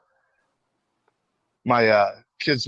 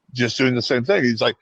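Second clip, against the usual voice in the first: ordinary delivery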